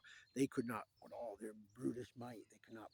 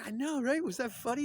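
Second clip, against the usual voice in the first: high, loud, tickled reply; gleeful agreement; 13 semitones higher, 9 dB louder than usual. Retold or imitated. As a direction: act out delighted agreement, pitched way up. Quotes own amused reply.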